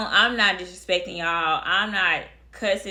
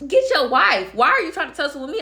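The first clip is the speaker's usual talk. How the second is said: raised, higher voice, imitating an argument; louder mock yell with a quick second question; retold angry demand; 5 semitones higher, 5 dB louder, faster than usual. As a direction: act out a loud, indignant confrontation. Retold shouting match between the men.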